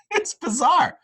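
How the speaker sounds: high, drawn-out squeaky falsetto; Dave Stewart. Acted. Host joining in mocking the pitcher, squeaky falsetto. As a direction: squeak it slowly, like a cartoon mouse. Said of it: "he sounds like Mickey Mouse".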